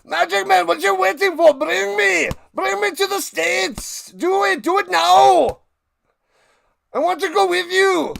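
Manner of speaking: high, full-force pleading yell; cartoonishly aggressive shouting; loud, aggressive talking ice cream. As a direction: bellow frantic demands, hammed-up action-hero style. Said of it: "ice cream has to be so loud and aggressive".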